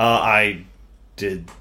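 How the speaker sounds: ordinary talk, nothing marked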